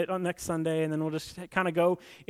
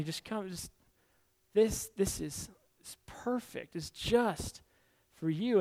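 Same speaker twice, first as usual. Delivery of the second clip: soft, hushed lure with a whispered perfect; tempting hushed voice, softer; alluring whisper; 6 dB quieter, slower than usual. Acted. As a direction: coax softly like a secret offer. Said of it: "temptation comes to us and says".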